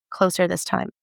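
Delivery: usual speaking voice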